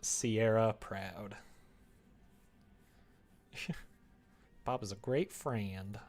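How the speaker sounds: ordinary talk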